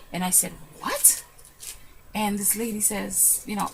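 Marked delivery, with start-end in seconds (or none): 0.8-1.2 sudden very high squeak; stunned surprise. Retold or imitated. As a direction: gasp it out in disbelief. Stunned at hearing her name.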